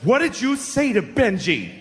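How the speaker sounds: high, drawn-out mock scolding; furious mother. Benji's mother confronts the boy who upset him, mock furious. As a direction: demand an answer like an outraged mom. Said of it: "she's furious".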